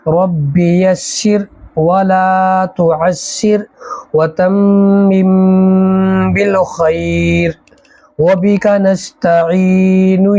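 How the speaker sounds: slow, measured Arabic prayer; prayer recital. Real, not acted. Teacher leads students in a prayer, slow and devout. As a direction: recite the dua slowly and reverently. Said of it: "पहले दुआ पढ़ लेते हैं".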